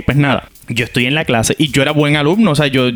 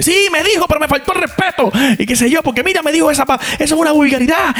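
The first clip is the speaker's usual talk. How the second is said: high, outraged teacher complaining; indignant teacher; 13 semitones higher than usual. Retold or imitated. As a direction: demand the kid be suspended. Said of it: "Llamó a la maestra". (maestra indignada, voz muy aguda)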